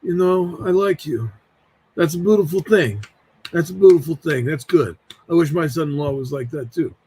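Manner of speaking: lightly voiced Italian uncle, approving; a hint of a put-on uncle voice; Uncle Freddie. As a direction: imitate a serious uncle giving his approval. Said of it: "I've always thought he sounded like this".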